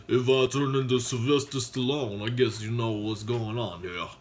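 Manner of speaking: low, mock Stallone voice; Sylvester Stallone impression. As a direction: do a joking Stallone. Jokes he turned into Stallone.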